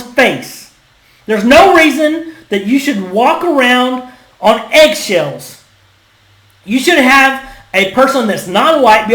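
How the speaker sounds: loud, slow, emphatic indignation; slow, forceful ranting; fired-up rant. Real, not acted. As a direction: drive each phrase home angrily. Fired-up rant against accusations.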